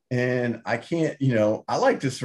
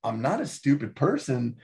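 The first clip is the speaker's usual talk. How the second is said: ordinary talk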